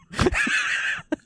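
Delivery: high airy wheeze of laughter; breathless laugh. Real, not acted. Laughing at pun.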